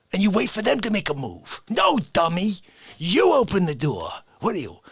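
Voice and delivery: ordinary talk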